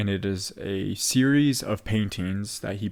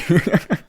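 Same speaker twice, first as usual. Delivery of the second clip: big burst of laughter; laughing out loud; 8 semitones higher, 8 dB louder than usual. Real, not acted. Delighted laugh at the quote.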